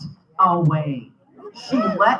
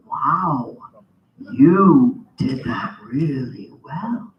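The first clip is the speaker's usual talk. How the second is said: slow, low, drawn-out praise; patronizing cab driver; 7 semitones lower, much slower than usual. Retold or imitated. Cab driver to blind passenger, slow patronizing praise. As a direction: imitate an over-impressed cabbie, stretching each word.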